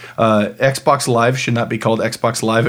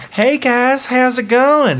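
much higher, dopey Southern voice; Southern accent; 12 semitones higher, slower than usual. Acted. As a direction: imitate a dim Southerner's greeting, high-pitched. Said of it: "with a thick Southern accent".

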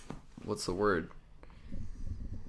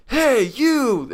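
much higher, louder threatening call; mock street shout; 13 semitones higher, 8 dB louder than usual. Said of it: "some guys are like".

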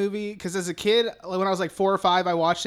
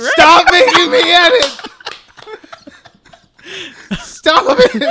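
high, loud mock-anguished shout; exasperated laughing yell; 9 semitones higher, 12 dB louder than usual. Real, not acted. Podcaster begging co-hosts to stop making editing work, laughing yell. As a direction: yell in protest while cracking up.

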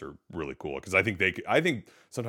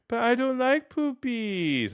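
put-on high, drawn-out little-kid whine; mock childish whine, pitched way up; whining daughter; much slower than usual. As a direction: imitate a child complaining, slowly. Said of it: "and then she'll say".

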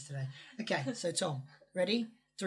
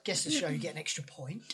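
usual speaking voice

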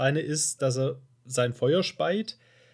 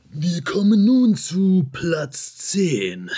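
movie trailer voice; 4 semitones higher, 7 dB louder, much slower than usual. Shown as a demonstration. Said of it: "die U-S-amerikanische Trailerstimme aus den neunzigern".

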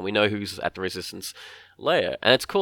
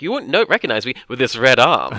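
lightly voiced chatty droid, louder; C-3PO; 3 semitones higher, 9 dB louder than usual. Droid explaining himself to Han, lightly mimicked and loud. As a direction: prattle on loudly, oblivious to the moment. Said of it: "And then he's like".

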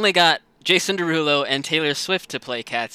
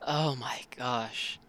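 slow horrified whisper; 3 semitones lower, 13 dB quieter, much slower than usual. Real, not acted. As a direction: breathe it out in disbelief. Reacting in horror to scene.

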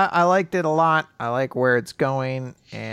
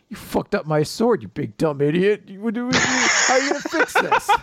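mock angry scolding, climbing higher; Yami; 7 semitones higher than usual. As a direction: rant with rising pretend outrage. Yami scolding Asta over sword.

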